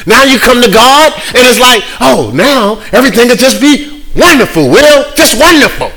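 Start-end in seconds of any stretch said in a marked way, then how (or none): 2.0-5.9 high, gushing daydream voice; mock dreamy bliss. Preacher imitating a starry-eyed new believer, high and gushing.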